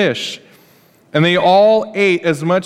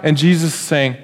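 usual speaking voice

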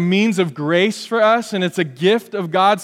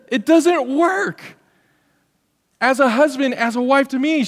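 preaching at full force; 7 semitones higher than usual. Real, not acted. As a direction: drive the point home from the pulpit. Preacher peaks again.

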